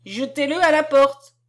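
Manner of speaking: loud, high-pitched imperious order; wrathful order. A narrator's reading. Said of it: "la voix courroucée de la commandante".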